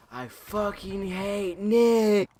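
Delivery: high, very loud, drawn-out scream; imitated enraged scream. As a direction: scream it slowly as the furious kid. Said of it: "He's screaming that shit loud".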